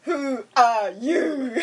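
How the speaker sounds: very slow, laughing; slow drawn-out English. Pretend. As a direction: stretch every word out. (ゆっくり引き伸ばして)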